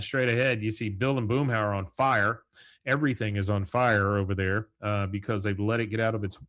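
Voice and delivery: ordinary talk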